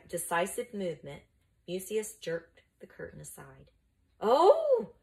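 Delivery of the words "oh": high, loud "oh" of amazement; amazed gasp. A narrator's reading. Mucius pulls the curtain aside, amazed. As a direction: let out a high, astonished oh. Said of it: "he breathed in amazement".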